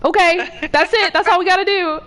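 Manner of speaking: mock satisfied. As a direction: sound pleased with doing almost nothing.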